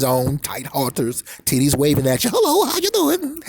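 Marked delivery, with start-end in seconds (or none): 2.3-3.4 high mock-flirty hello; flirty greeting. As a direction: greet him sweetly in a higher put-on voice. Women greeting men, acted.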